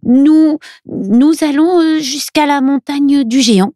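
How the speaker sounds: high, hesitant elf voice, halting after "nous"; stammering elf. A narrator's reading. Milo haltingly tells the old man where they go, stammering. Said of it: "Milo bredouille".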